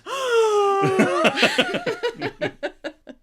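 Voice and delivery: loud high gasp with a laugh; shocked gasp. Retold or imitated. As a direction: gasp in horror, half laughing. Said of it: "I T goes".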